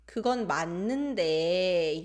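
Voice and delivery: reluctant, lingering fall on 데; hesitant falling intonation. Shown as a demonstration. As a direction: let 맞는데 trail downward, unfinished. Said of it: "goes down".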